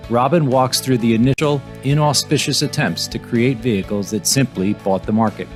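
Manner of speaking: projected voice-over for an episode preview; podcast intro read. Real, not acted. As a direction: read the episode intro with firm, measured emphasis. Pre-recorded podcast introduction.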